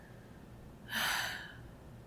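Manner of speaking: long, longing sigh; wistful sigh. Acted. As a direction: sigh out with missing someone. Longing for distant partner.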